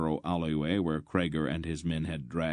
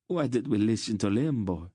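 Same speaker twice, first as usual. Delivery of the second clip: higher-pitched wail of regret; 5 semitones higher than usual. A narrator's reading. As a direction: lament it like a panicking merchant. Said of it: "the first merchant wailed".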